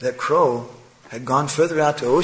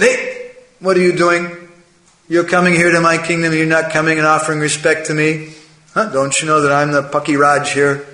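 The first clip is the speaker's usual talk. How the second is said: loud, higher-pitched, acted outrage; indignant challenge; 4 semitones higher, 4 dB louder than usual. Proud crow challenging swans flying over his kingdom, indignant. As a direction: demand respect as the self-declared king.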